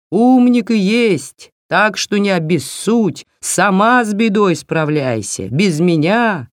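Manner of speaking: lightly voiced grumpy old owl; a hint of a sulky owl refusing help. Owl character voice in tale. (слегка сварливый голос филина)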